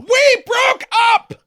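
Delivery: very high, loud, slow wail; mock shouted excuse. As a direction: scream it like an indignant ex. Voicing the heroine's excuse.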